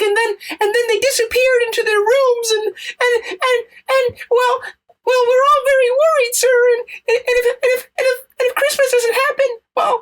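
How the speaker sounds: very high, fast, stammering sobs; a frantic little reindeer begging for help through tears; panicked crying reindeer. Acted. Rudolph begging the rabbi to save Christmas, sobbing and squeaky.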